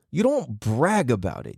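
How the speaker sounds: ordinary delivery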